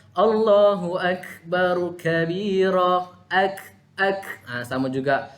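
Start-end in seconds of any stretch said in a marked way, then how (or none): 0.1-3.1 "Allahu Akbar kabira" slow, each letter sounded fully; drawn-out takbir. Shown as a demonstration. Ustaz reciting for the class, slow and clear.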